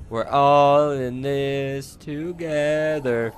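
slow, stretched-out words; placating singsong. Pretend. Player placates nearby creature, slow singsong. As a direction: draw it out to soothe a beast.

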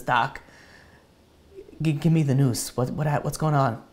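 ordinary delivery

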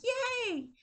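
high-pitched cheer; happy kid. Acted. Teacher imitates kid in the rain, high happy cheer. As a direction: imitate a child's squeal of joy. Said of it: "He's happy".

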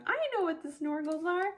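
put-on high, quick childish call from the next room; squeaky kid voice yelling across the house; daughter yelling. Mom imitating her daughter yelling from the background. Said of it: "My daughter's yelling in the background".

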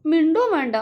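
put-on girl pleading to leave, voice breaking. A narrator's reading. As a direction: let the voice crack on each repeat. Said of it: "ഇടർച്ചയോടെ പറഞ്ഞു".

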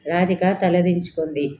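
usual speaking voice

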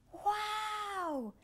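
amazed wow; awed wow. Acted. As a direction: breathe out wonder. Awe under sea.

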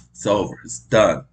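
ordinary talk, nothing marked

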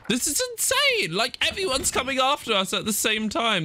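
shrill high panic, easing down; frantic player, voice way up then settling; real panic. Real, not acted. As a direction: yelp it as everything chases you. Everyone chasing, panic.

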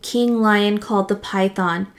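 usual speaking voice

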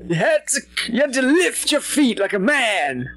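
put-on high crotchety old-timer; old man voice. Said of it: "old people voices".

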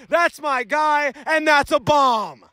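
normal talking voice

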